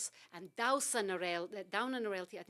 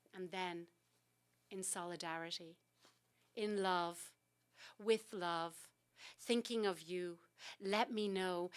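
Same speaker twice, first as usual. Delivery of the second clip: poem reading; 2 semitones higher, 5 dB louder than usual. Acted. Poet reads her genocide poem to the host, building recital. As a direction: let the repeated phrases build, then drop.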